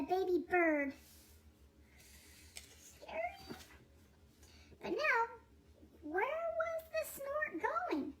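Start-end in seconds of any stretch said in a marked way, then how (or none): 2.8-3.6 high, hushed spooky aside; a playful shiver for the kids; mock scared aside. A narrator's reading. Narrator's aside, mock fright. 4.8-8.1 high, slow, wondering; suspenseful question. A narrator's reading. Narrator builds suspense.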